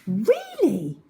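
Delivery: can't believe it; girl astonished by crocodile tale; amazed. A narrator's reading.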